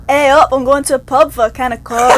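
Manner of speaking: laughing attempt at Yorkshire A up, Coke too Canadian; Canadian Yorkshire try. Shown as a demonstration. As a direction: repeat the Yorkshire line with a Canadian tinge, laughing. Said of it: "Canadianism in the whole thing".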